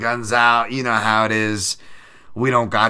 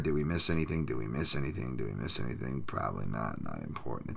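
each repeat lower and softer until it trails off; fading mumble; 9 semitones lower, 10 dB quieter than usual. Real, not acted. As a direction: let it drift away to nothing. Repeats question, fading away.

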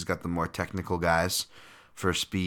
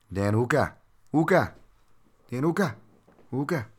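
a rough, self-mocking New Zealand accent on the name, pitched up; poor New Zealand accent; 8 semitones higher, 5 dB louder than usual. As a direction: try a Kiwi accent on the name and miss a little. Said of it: "my poor attempt at a Anzac or New Zealand there, uh, accent".

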